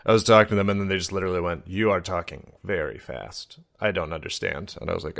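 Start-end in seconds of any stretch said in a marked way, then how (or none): 1.7-4.8 slow, soft and deliberate quote; slowed-down plea. Retold or imitated. Uber driver telling a fast talker they can't follow, slow and deliberate. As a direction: imitate someone spacing words out to be understood.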